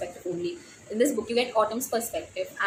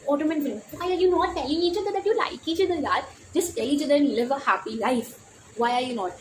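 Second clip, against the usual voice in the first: high, fast pleading at the characters; exasperated pleading; 5 semitones higher than usual. Real, not acted. Reader pleading with fictional lovers, exasperated and fast. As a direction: beg them to confess, frustrated. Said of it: "scolding characters in frustration".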